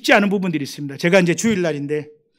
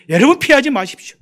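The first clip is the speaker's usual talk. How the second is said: 여러분, 피하지 마십시오 louder and higher; urgent pulpit cry; forceful exhortation; 6 semitones higher, 7 dB louder than usual. Acted. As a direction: call out 여러분, 피하지 마십시오 to the whole room. Exhortation repeated strongly.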